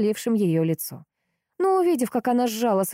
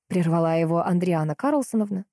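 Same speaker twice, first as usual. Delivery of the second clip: ordinary delivery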